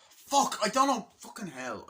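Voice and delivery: real exasperated exclamation; frustrated outburst. Real, not acted. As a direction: blurt it out, annoyed at losing the thread. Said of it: "I got so fucking distracted there".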